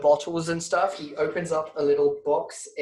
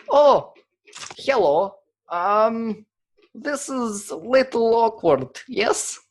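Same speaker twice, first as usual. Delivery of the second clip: put-on Russian accent; 6 semitones higher, slower than usual. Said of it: "he spouts some Soviet".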